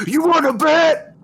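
defiant shout. Acted. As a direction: shout it into the darkness, high and loud. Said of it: "Gator just shouts into the darkness".

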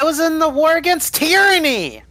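very high, loud protest; mock indignant shout. Veteran defends his war record, high mock shouting. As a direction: yell it in hammed-up outrage.

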